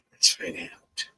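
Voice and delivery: whispered Canadian "Let's find out", soft and breathy; Canadian accent. Shown as a demonstration. Tarot reader hushing to viewers in Canadian accent. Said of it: "I did that in Canadian accent".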